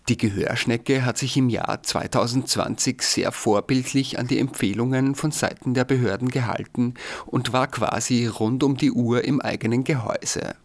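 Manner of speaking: ordinary talk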